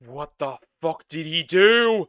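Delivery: loud, rising, real disbelief; incredulous outburst. Real, not acted. Incredulous reaction to destruction on screen.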